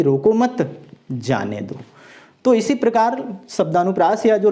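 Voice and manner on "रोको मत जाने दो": रोको मत run together, break, then low जाने दो; pause after मत. Shown as a demonstration. Teacher repeats the permitting reading, pause after मत. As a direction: slowly join रोको मत, pause before जाने दो. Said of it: "उसको अनुमति दी जा रही है".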